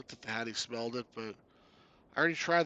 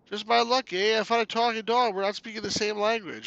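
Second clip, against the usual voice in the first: higher, louder mock-exasperated Canadian voice; exasperated Canuck hero; 9 semitones higher, 9 dB louder than usual. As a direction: grumble loudly as a cartoon Canuck.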